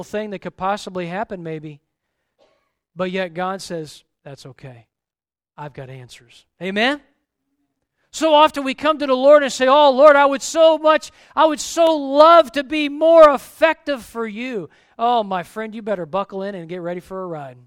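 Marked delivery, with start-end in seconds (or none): 4.2-6.4 much softer, lower, tender God voice; calm him down quietly; gentle reassurance. Acted. God softly reassuring young Gideon, tender and low. 9.7-14.7 loud, higher, pleading cry to the Lord; hammed-up fervent prayer. Believers crying out to the Lord, hammed-up fervent plea.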